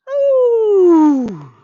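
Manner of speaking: long loud high ooh; a big stretching ooh. Acted. Teacher stretching up with the kids, ooh.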